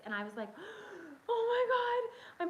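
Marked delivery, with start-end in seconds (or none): 0.5-1.2 gasp. Retold or imitated. Panicking over bleeding turkey. 1.3-2.5 high, loud, crying oh my God; panicked cry. Retold or imitated. Mom retelling her panic over the bleeding turkey, crying out.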